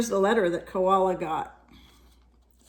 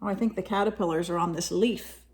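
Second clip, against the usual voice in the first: plain reading voice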